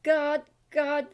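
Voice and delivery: loud, slow repeated echo; echo imitation. Shown as a demonstration. Vocalist imitating the delay for viewers, echoing. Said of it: "to get that God, God, God".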